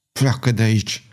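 tight, contained threat; hissed through teeth. A narrator's reading. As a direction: hiss the order through gritted teeth. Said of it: "șuieră printre dinți".

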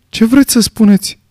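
Vera's worried question pitched high; uneasy question. A narrator's reading. Vera uneasily pressing the general about his meaning. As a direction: ask anxiously, a little rattled. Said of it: "întrebă Vera neliniștită".

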